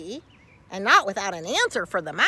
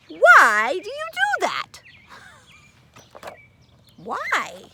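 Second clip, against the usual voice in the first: high, loud little mouse voice; field mouse voice; 12 semitones higher, 6 dB louder than usual. Acted. Curious field mouse asking Carl the question, high and loud.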